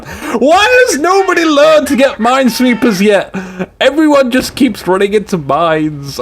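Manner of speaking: high, drawn-out wail of disbelief; high exasperated rant, easing off at the end; exasperated outcry. Real, not acted. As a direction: wail in comic despair at the players. Exasperated at players hitting mines.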